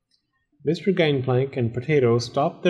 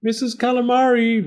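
high, sing-out call; raised storybook call; 12 semitones higher, 4 dB louder than usual. A narrator's reading. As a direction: call to her from the kitchen. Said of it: "Mister Gangplank called".

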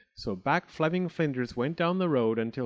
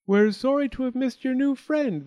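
high, loud sing-song politeness; chirpy genteel apology; polite parents; 12 semitones higher, 6 dB louder than usual. Acted.

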